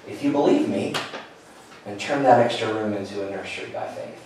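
soft and low, a quiet inner voice; hushed voice of God. Retold or imitated. Recalling God quietly speaking to him, soft and low. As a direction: let it land gently, like a whisper heard inside.

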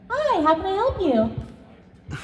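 bright, high, slow retail greeting; chirpy shop clerk helping a customer; customer service voice. Shown as a demonstration. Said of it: "My customer service voice".

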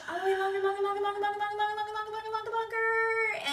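high, loud sing-song chant; loud cheering. Shown as a demonstration. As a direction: keep cheering the blow going. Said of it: "coach cheers exhale longer".

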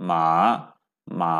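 মা dipping low then rising, Chinese third tone; Mandarin third tone. Shown as a demonstration. Teacher models the dipping tone of মা for the class. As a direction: drop মা down low, then let it come back up. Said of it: "তাহলে মা third tone".

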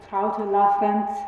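Traute Lafrenz said slowly with German vowels; German pronunciation. Shown as a demonstration. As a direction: give Traute Lafrenz a clear German accent. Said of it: "pronouncing most of the names with a decidedly German accent".

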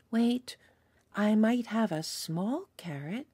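usual speaking voice

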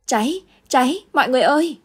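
high, loud alarm cry; panicked shout of fire. Acted. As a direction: yell the warning to the whole house. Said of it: "Có tiếng ai đó la lớn lên".

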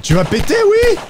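high excited urging, rising to a yell. Real, not acted. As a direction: egg it on to explode. Urging enemy to explode in game.

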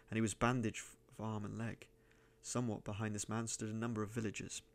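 ordinary delivery, nothing marked

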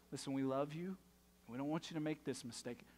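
low, soft, tender reassurance; gentle parent talk. Retold or imitated. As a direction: imitate a parent soothing a child. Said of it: "it was one of those".

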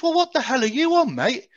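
high-pitched, incredulous retort; baffled disbelief. Retold or imitated. Soldier answering the Cockney-speaking prisoner, in acted disbelief. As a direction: act out a startled comeback, pitch jumping up.

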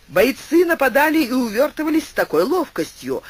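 ordinary delivery

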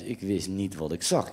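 ordinary delivery

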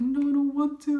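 tearful no to mom; crying refusal. Retold or imitated. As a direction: refuse mom through tears. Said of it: "I was still crying".

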